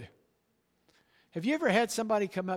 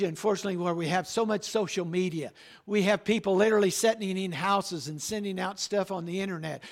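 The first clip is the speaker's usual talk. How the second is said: normal talking voice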